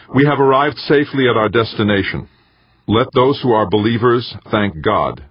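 rousing speech; formal speech. Acted. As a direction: address the crowd, rising high. Inauguration speech to audience.

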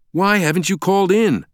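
high-pitched, indignant question; angry demand. A narrator's reading. Stranger demands answers from Langdon, angrily. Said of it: "the man demanded".

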